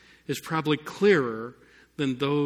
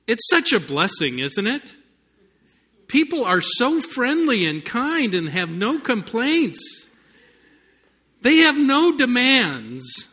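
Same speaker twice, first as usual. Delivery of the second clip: sarcastic; 8 semitones higher, 7 dB louder than usual. Acted. Sarcasm about customer service work.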